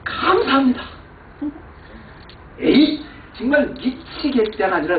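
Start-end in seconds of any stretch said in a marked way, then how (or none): 0.0-0.8 a much higher, louder burst of gratitude; overjoyed cry of thanks; exaggerated thanks. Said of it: "욕을 한 게 아니라". 2.6-3.0 exclamation. Retold or imitated. Mock frustration turned praise.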